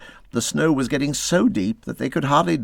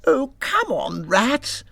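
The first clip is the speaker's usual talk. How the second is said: impatient; slower than usual. A narrator's reading. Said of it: "remarking at intervals".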